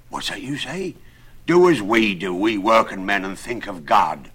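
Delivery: lightly voiced rustic working man; emphatic, simple tradesman; earnest carpenter. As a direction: urge him firmly and piously. Carpenter answers Nicholas.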